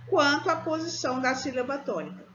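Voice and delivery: ordinary delivery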